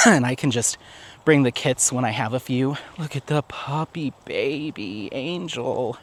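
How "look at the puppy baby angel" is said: cooing at puppy. Real, not acted. Vlogger cooing at her puppy outside, soft baby talk. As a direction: coo over the puppy softly and slowly.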